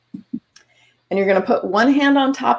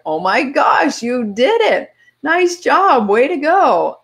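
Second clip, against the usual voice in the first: bright, rising cheer, louder as it goes; excited self-praise; 6 semitones higher, 4 dB louder, slower than usual. Retold or imitated. Praising yourself after reaching the goal, excited cheer. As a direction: cheer yourself on like you just won.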